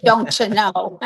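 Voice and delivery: a lightly put-on Canadian accent through a laugh. Guest greeting a Canadian viewer in a mock Canadian accent. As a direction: toss off a playful Canadian-accent don't you know. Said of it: "I should do a Canadian accent".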